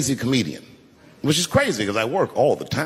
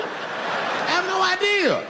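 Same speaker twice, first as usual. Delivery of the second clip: much higher, very slow, laughing; high, drawn-out giggling confession; high sheepish squeak; much slower than usual. Real, not acted. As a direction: squeak out the admission, embarrassed. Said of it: "admits cluelessness".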